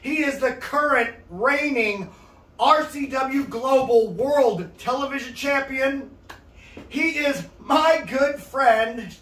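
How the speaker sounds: slow, drawn-out ring announcer; announcer intro. Acted. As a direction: build up the champion grandly. Introducing champion guest, slow and grand.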